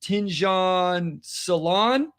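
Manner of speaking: Tinjon Salon sounded out very slowly, ending on a questioning rise; unsure pronunciation. Real, not acted. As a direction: feel your way haltingly through "Tinjon Salon". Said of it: "I'm gonna need the pronunciation guide".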